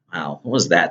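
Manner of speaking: normal talking voice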